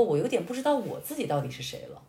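ordinary delivery

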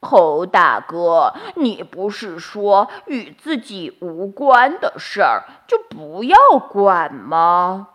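slow, teasing goat drawing it out; deliberately slow. Acted. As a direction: take your sweet time on purpose. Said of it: "山羊故意慢吞吞地说".

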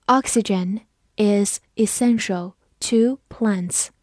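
slow, word-by-word model sentence; slow read-through. Shown as a demonstration. Teacher models a science sentence for learners, slow read-through. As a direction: leave clear pauses between the words. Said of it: "我们慢读一遍".

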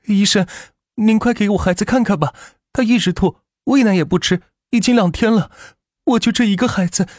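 high, tearful woman's plea; desperate young mother. Acted. Mother begging a doctor to save her child, desperate. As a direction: beg anxiously for the child's life.

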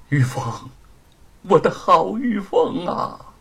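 tearful longing. Acted. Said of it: "眼含热泪".

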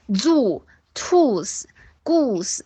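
"zoo, tooth, goose" with a Chinese wu vowel; "zoo, tooth, goose" with tight lip-made oo; wrong OO. Retold or imitated. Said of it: "不是这样的".

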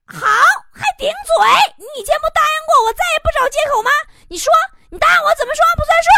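high, loud, fast tirade; angry wife. Acted. Wife berates husband for his excuse, furious high shouting. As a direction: tear into him at a shout. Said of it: "媳妇儿说".

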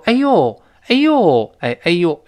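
high loud surprised aiyo; mock surprised aiyo; surprised exclamation. Shown as a demonstration. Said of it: "类似于中文中".

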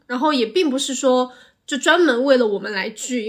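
usual speaking voice

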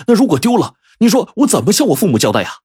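high, agitated appeal; anxious protest. A narrator's reading. Villager afraid of losing the jade his parents left, agitated. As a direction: press the worried question, pitched high and urgent.

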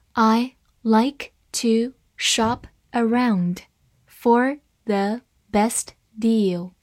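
slow, word by word, each word clear; slow reading. Shown as a demonstration. Teacher reads the sentence slowly to students. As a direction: read it slowly for learners. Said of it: "好，慢慢来读。".

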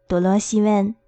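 ordinary delivery

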